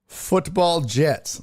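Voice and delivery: slow, stately announcer read of the team name; mock grand announcer. Host reading the firing news in a slow, grand announcer voice. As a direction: stretch "Football Jets" like a stadium announcer. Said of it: "I don't know why I said it like that".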